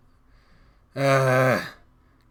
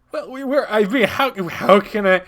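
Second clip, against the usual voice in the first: higher, stumbling over an excuse; flustered stammer; 6 semitones higher, 6 dB louder than usual. Acted. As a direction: caught out, grasp for words. Caught leaving, stammers for an excuse.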